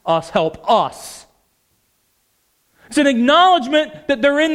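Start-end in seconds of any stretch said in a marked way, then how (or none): 0.0-1.2 "Us, help us" slow, low, with weight on "us"; stress on us. Shown as a demonstration. Preacher spelling out the father's plea, slow with "us" stressed. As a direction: lean slowly on "us" each time. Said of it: "What did he say?".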